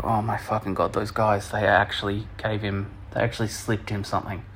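low, soft muttered dismay; retold quiet realisation; hushed disbelief. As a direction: mutter it to yourself, appalled. Retold reaction to roofie news.

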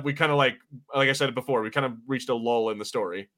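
ordinary talk, nothing marked

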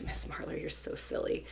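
put-on near-whispered child's aside; softly tease, almost no voice; hushed teasing. Student to teacher after her funny sound, hushed and amused.

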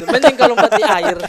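loud imitation of a heckler; mock shouted suggestion; retold yell. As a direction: call it out loudly, mimicking the heckler. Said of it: "dia teriak, dia bilang".

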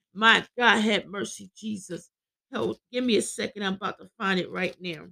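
usual speaking voice

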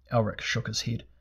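ordinary delivery, nothing marked

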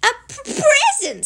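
high, stammered shout; piercingly high little voice; Piglet very loud. A narrator's reading. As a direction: burst it out loud for poor hearing. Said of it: "said Piglet very loudly".

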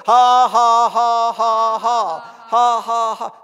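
very loud, deliberate forced laughter; Brother Hagin's dry laugh. Retold or imitated. Preacher imitates a believer answering the devil, loud forced laughing. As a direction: belt out each "ha" with no real amusement. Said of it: "He, he's laughing".

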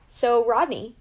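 usual speaking voice

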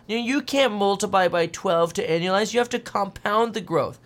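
high-pitched mock freak-out; mocking, high-voiced outraged objection; freaking-out Tesla bulls. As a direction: imitate worked-up fans protesting, pitched well up. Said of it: "start freaking out and going".

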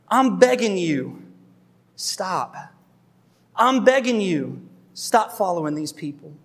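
slow, heartfelt begging; earnest plea. Retold or imitated. Paul begging the Galatians to stop, earnest and slow. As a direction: plead from the heart, holding back.

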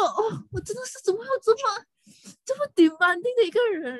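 can't believe anyone is this demanding; incredulous whine. Real, not acted. Complaining to friends about the client, incredulous whine.

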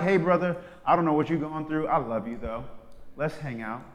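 reach out kindly, quietly; softly, kindly; gentle invitation. Retold or imitated. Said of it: "and was like".

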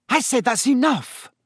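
high, sharp scolding; exasperated order. Acted. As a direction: cut off the nonsense, out of patience. Mother repeats order, losing patience.

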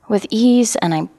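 ordinary talk, nothing marked

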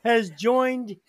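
put-on a half-joking Mexican-in-France accent for the film summary. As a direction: read the synopsis in a mock Mexican-in-France accent. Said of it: "You sound like a Mexican living in France".

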